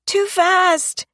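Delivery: put-on high little girl's voice, crying out; a small child shouting in alarm. A narrator's reading. Girl on the swing shouting at Mary to slow down. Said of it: "shouted the little girl".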